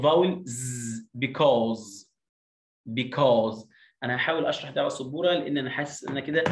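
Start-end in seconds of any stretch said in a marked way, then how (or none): 0.4-1.1 ز as a sustained voiced Z. Shown as a demonstration. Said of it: "والاس بنطقها". 1.2-3.7 slow model of because with a long 'aw' and buzzing Z; modelled pronunciation. Shown as a demonstration. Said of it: "واي يو بتعمل لونج او".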